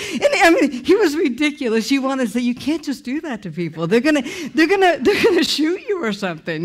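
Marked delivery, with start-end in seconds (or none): 2.4-6.6 amused protest. Retold or imitated. Mock warning, amused at husband's boldness.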